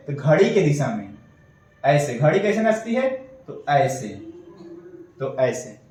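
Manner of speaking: ordinary talk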